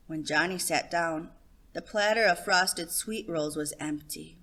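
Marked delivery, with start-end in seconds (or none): none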